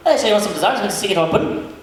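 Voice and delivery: rapid, run-together Scottish brogue; fast Scottish talker. Retold or imitated. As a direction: imitate a Highlander rattling off words in Scottish. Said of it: "he talked so fast".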